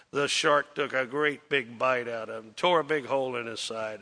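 ordinary delivery

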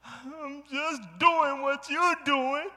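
put-on high, slow small-boy voice; imitating child. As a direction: quote the son simply and slowly. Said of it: "He said".

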